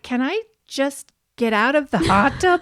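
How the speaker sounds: pleading Liz. Retold or imitated. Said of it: "Liz is like".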